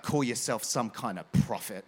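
low-pitched mock indignation; upset scoff. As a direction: imitate an offended man scoffing. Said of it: "he got really, really upset with me".

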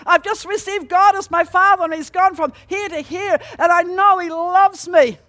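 loud, high, bursting with joy; overjoyed. Retold or imitated. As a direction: blurt the happy news out to him. Excited telling husband the news.

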